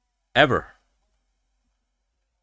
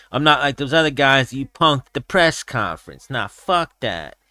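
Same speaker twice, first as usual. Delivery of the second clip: higher, defiant imitation of a Diaz accent; Diaz brother; 3 semitones higher than usual. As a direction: imitate a defiant fighter, drawling at the end. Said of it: "when he was like".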